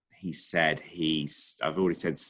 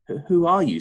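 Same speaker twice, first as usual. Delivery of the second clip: much higher, louder startled splutter; 11 semitones higher, 7 dB louder than usual. Acted. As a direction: blurt it out in sheer shock. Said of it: "spluttered Billy".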